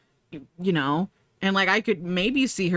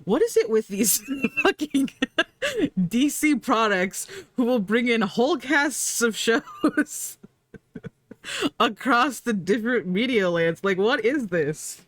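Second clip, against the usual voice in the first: laughing disbelief; 5 semitones higher than usual. Retold or imitated. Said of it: "I was like".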